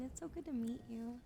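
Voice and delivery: ordinary talk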